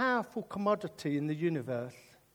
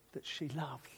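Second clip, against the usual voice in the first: ordinary talk, nothing marked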